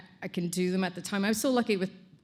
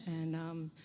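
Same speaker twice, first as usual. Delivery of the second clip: usual speaking voice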